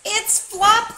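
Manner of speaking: high, loud happy outburst; joyful cry. A narrator's reading. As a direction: cry out in delighted relief. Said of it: "they cried out".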